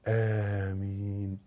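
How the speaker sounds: soft, low, long-drawn amen; prayerful request. Shown as a demonstration. Teacher showing amen as a request, soft slow plea. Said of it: "اطلب".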